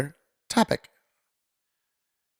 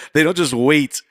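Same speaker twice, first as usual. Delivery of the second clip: amused disbelief, chuckling it out; laughing; 4 semitones higher, 4 dB louder than usual. Real, not acted. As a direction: laugh through the words, a bit higher and louder. Repeats line, laughing through it.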